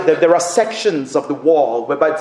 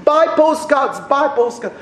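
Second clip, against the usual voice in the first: higher, slow, insistent broken-English plea; pleading street vendor; 9 semitones higher than usual. Retold or imitated. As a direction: beg like a pushy old vendor, slowly and high. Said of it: "waving her hand".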